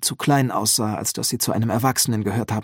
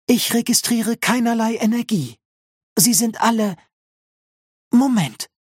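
higher-pitched robot nurse, uneasy; worried; 10 semitones higher, 3 dB louder than usual. A narrator's reading. Nurse examining the androids, worried and higher. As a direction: report it with raised, concerned voice. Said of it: "klang beunruhigt".

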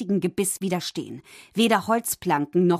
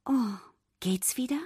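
worried; 4 semitones higher, 4 dB quieter than usual. A narrator's reading. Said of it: "fragte Ariel besorgt".

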